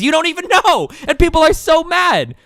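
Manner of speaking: high, loud, incredulous rant; exasperated outburst. Real, not acted. Ranting at outraged chatters.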